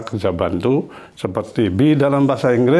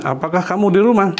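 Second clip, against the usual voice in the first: Apakah kamu di rumah asked higher and fast; quick raised example question; 7 semitones higher, faster than usual. Shown as a demonstration. Teacher gives a sample question higher and quickly.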